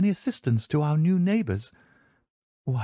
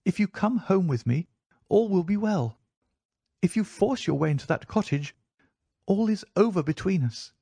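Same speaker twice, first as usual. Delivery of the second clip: higher-pitched pleading wife; urgent entreaty in a high, light voice; earnest, despairing plea; 6 semitones higher than usual. A narrator's reading. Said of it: "such despair in her manner".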